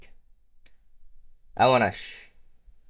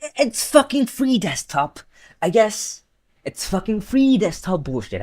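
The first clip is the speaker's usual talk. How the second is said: exasperated high rant; 8 semitones higher, 4 dB louder than usual. Real, not acted.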